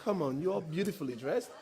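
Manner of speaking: high, loud, laughing encouragement; host laughing as he coaxes the audience to walk up. Real, not acted. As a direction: coax the shy audience up with laughter. Coaxing audience onto stage.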